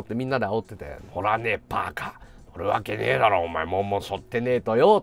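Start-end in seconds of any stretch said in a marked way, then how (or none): 1.1-5.0 low, gruff refusal; gruff low grumble. Retold or imitated. Senior refusing friends' urging, low gruff grumble. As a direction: grumble it down low, not giving in. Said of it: "っていうくだり".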